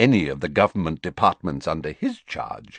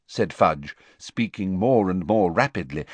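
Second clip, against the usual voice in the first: ordinary delivery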